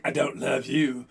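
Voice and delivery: low, slow, flat gargoyle rejection; lightly voiced cold gargoyle. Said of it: "they just talk like that".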